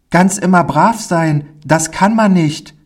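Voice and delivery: put-on high child's voice; artless little boy. Pauli admits to the man he can't always behave, high boyish voice. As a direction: answer as a small boy, pitched high.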